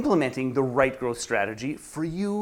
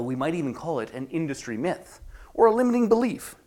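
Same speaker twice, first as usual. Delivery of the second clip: normal talking voice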